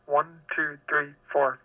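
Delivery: ordinary delivery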